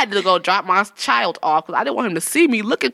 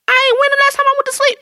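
much higher, panicked question; mock panic; 3 dB louder, faster than usual. Retelling her first breakup to friends, high panicked disbelief. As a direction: squeal it in disbelief. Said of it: "I looked up again and I was like".

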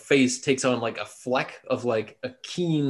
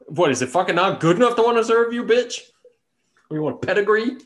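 louder, fast, mock-offended rant; mock indignant outburst; 8 semitones higher, 5 dB louder, faster than usual. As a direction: blurt it out like an insulted orc, fast and loud. Player mocks orc reaction.

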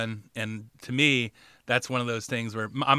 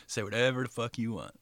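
normal talking voice

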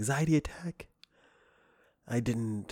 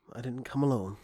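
normal talking voice

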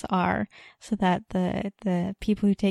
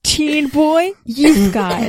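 narrow-eyed suspicion; 6 semitones higher, 6 dB louder, much slower than usual. A narrator's reading. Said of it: "the woman said with narrowed eyes".

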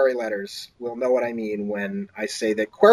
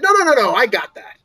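insisting; 8 semitones higher, 3 dB louder than usual. Retold or imitated. Agent insisting on paying.

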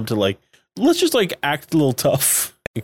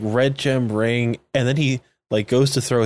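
ordinary talk, nothing marked

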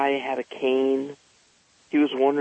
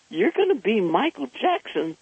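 surprised echo; 9 semitones higher than usual. Retold or imitated. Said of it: "And I said, "What?".

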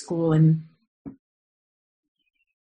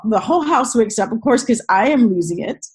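usual speaking voice

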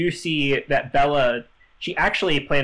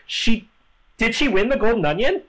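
pitched-up, gasping disbelief; mock amazement; 8 semitones higher than usual. Host asks about the Golden Onion, mock astonished and higher. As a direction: act stunned she won it.

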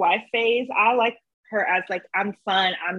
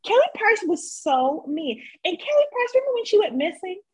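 excited gossip; 10 semitones higher than usual. Real, not acted.

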